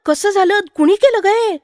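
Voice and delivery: high, alarmed women's voices; startled village women crying out questions; frightened women asking. A narrator's reading. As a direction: ask in high-pitched alarm. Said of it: "विचारू लागल्या".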